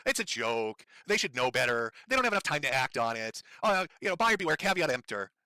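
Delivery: mocking excuses. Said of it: "your little bullshit excuses".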